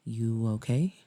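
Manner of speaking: low man's voice, gently asking; Maxwell. Acted. Maxwell leans in with concern, low voice. Maxwell checks on her, in character.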